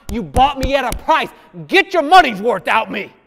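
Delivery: loud, high prayer cry; crying out to God. Retold or imitated. Preacher retelling his own prayer to God, crying out loud. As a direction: cry it out to God, pleading to be used. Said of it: "these are the words that come out my mouth".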